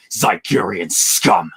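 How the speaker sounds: slow, low menacing villain snarl. Retold or imitated. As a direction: spit it out like a Sith villain. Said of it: "you have the ideal villain voice".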